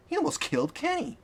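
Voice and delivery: high, pained outcry; upset cry. A narrator's reading. Companion upset about Kenny's wound, high-pitched. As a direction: pitch it right up in alarm. Character cries out.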